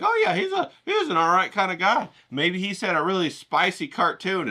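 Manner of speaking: a viewer sizing up the painter, in a lightly mocking high voice; imagined casual viewer. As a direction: play a passing viewer giving a breezy verdict, up high. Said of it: "so you'd be like".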